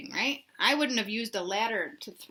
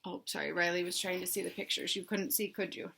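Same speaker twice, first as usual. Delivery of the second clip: quieter, off-hand aside; soft aside; 2 semitones lower, 9 dB quieter than usual. Real, not acted. Teacher breaks off to check Riley can see the pictures, soft aside. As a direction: drop your voice for a quick apology.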